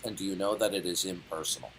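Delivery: normal talking voice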